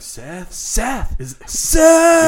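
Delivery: mock anguished calling. Friend calling to a ghost, mock anguished scream. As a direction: call out a name, building to a high scream.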